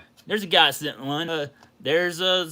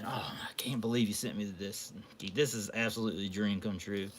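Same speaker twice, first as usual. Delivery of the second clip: soft, stunned whisper; awed whisper; 5 semitones lower, 10 dB quieter than usual. Real, not acted. Thanking a friend for the gift, stunned whisper. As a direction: breathe it in disbelief.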